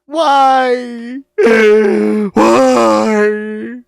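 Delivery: high, loud sobbing cries of why; desperate howling. Acted. Heartbroken deer howling at the bear, loud sobbing. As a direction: howl it out between gasps. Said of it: "A desperate howling sound".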